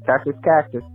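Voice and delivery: ordinary delivery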